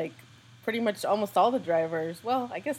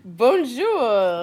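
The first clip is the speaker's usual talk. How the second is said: bright, louder bonjour in a mock French host voice; playful French greeting; 5 semitones higher, 8 dB louder than usual. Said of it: "Okay, back to English".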